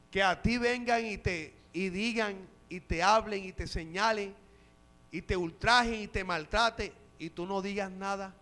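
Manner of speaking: ordinary delivery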